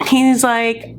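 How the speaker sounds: normal talking voice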